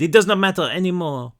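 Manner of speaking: put-on young French girl speaking careful English; French accent. A narrator's reading. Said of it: "she said in broken English".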